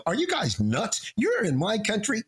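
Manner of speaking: hammed-up amazed barman; incredulous bartender. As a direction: play the bartender who can't believe his ears. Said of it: "the bartender's gonna look at you and me and go".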